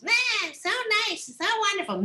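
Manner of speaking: high, sing-song mock praise. Jealous left rock mimics the praise, high and mocking. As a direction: parody the gushing compliments. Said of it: "all I hear is".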